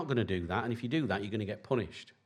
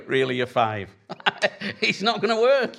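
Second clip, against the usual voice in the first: laughing; 8 semitones higher, 5 dB louder than usual. Real, not acted. Father laughing at child's tantrum.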